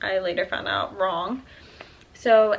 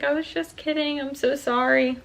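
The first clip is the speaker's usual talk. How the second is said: retold apology in a raised pitch; imitating a partner's high-pitched apology; apologetic; 7 semitones higher than usual. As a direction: plead sorry in a higher voice.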